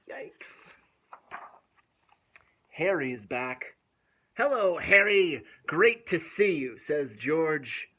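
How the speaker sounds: lively storybook reading with gasps; reading to children. A narrator's reading. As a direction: read it aloud to little kids. Reads picture book to children.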